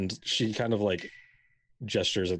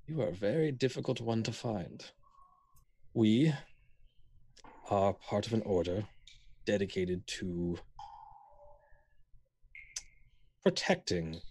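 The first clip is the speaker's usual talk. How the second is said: put-on very old woman; 4 dB quieter than usual. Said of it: "She goes".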